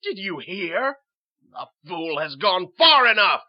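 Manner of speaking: high question rising to a loud shout; loud indignation; outraged. Acted. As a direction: build to an indignant yell. Outraged reaction to king's order.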